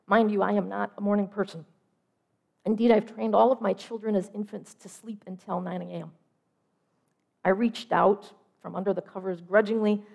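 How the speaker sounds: usual speaking voice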